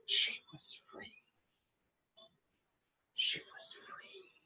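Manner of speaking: breathless whispered ending. A narrator's reading. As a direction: let the story fade in a whisper.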